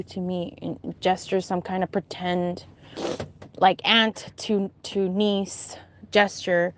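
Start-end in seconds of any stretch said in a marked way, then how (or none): none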